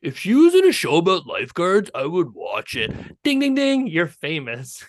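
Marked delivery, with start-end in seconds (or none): 0.0-2.9 higher, louder dumb guy ogling; leering bro. Pretend. Host mocks a male fan ogling a woman in the stands. Imitating guys watching sports. 3.2-3.9 chime. Pretend. Sound effect of fame.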